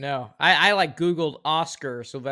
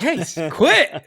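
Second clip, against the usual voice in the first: mock yell; 8 semitones higher, 9 dB louder, slower than usual. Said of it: "He's like".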